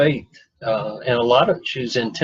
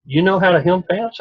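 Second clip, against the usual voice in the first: mildly surprised question; 6 semitones higher, 3 dB louder than usual. Retold or imitated. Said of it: "And she said".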